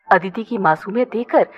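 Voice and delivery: usual speaking voice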